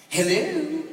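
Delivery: high, loud, drawn-out mock greeting; Doug Weed's phone hello. Doug answering his home phone, high-pitched comic hello. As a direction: send the hello way up in a comic imitation. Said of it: "This is how Doug answers".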